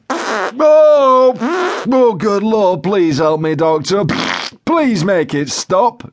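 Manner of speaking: high moan sinking into drawn-out begging; Joe Windass pleading. A narrator's reading. Joe begs the paramedic for help, pleading desperately. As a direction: implore the doctor for a miracle cure. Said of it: "he said, pleading with me once more".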